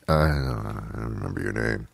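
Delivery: guilty little confession, dropping low and quiet; sheepish mumble. Acted. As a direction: admit it under your breath. Sheepish admission, forgot partner's name.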